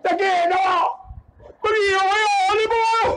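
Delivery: high-pitched mock crying to mother; child's wail. Acting crying child after caning. (tangisan budak kena rotan)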